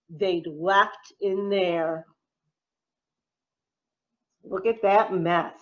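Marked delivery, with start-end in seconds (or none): none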